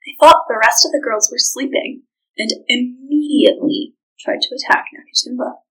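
plain reading voice

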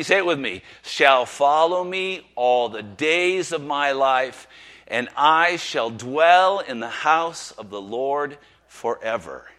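slow, loud, lifted recitation of the psalm; solemn scripture reading. Acted. Preacher reciting the psalm to the church, slow and full-voiced. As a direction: proclaim each line to the congregation.

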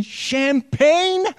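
high, startled cry of champagne; shocked. Pretend. Sisters horrified to find champagne, shocked high cry. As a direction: gasp out the word in horror.

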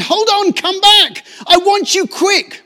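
high, loud urgent calling. A narrator's reading. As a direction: call after a friend who walks away. Mole calling Ratty back.

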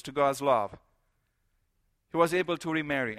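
usual speaking voice

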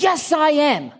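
high, emphatic comeback in playful imitation; upset wife. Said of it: "she was upset".

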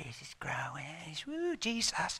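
hushed, breathless whisper; whispered gossip. Acted. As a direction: whisper it like a secret. Demon whispers about Paul.

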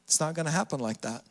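quick, low, soft dismissal; quietly deflate the crowd's hopes; understated Jesus reply. Acted. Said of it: "And Jesus is like".

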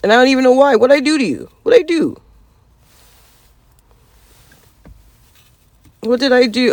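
high, loud offended whine; indignant outburst. Real, not acted. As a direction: demand it, pitch climbing in outrage.

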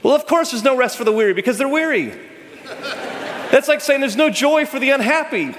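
high, quick incredulous tone; playful incredulity; amused mockery. Real, not acted. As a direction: poke fun at the obvious saying. Mocking the common phrase.

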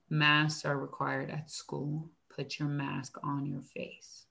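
slow, low, quiet retold reply; measured and soft, letting each word land; calm instruction. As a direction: calmly state the rule, unhurried. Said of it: "I just calmly said".

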